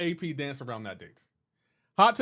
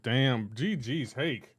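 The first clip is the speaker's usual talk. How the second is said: ordinary talk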